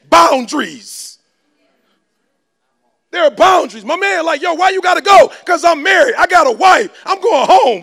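loud, high, driving sermon voice; full-force preaching. Acted. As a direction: preach it hard to the crowd.

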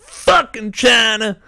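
high, loud, slow sneering curse; mock angry caller. Coworker mocking a furious caller who hangs up. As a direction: spit it out like a mocked hothead. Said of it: "making fun of this guy who called in and was pissed off".

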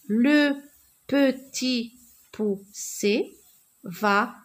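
very slow syllable-by-syllable reading; halting, evenly spaced model reading; reading with teacher. Shown as a demonstration. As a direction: break every word into separate beats for beginners. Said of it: "je lis avec le maître".

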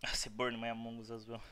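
ordinary talk

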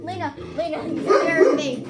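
loud startled cry at the dog; real fright, loud and high; startled yell. Real, not acted. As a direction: jump and shout her name. Dog barks suddenly, startled.

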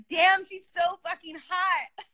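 high, drawn-out gushing; admiring exclamation. Retold or imitated. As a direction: retell being smitten, stretching the words. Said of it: "I was like".

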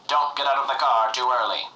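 ordinary talk, nothing marked

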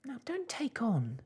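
put-on high, soothing girlish plea; begging. A narrator's reading. Daughter soothes upset mother, pleading. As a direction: coax her to calm down. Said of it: "begged Arrietty".

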